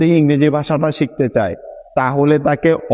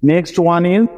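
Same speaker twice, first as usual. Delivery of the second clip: ordinary talk, nothing marked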